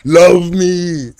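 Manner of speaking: high, loud swooning plea; lovestruck stare voice. Shown as a demonstration. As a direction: beg to be loved, hammed up. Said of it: "or is it like".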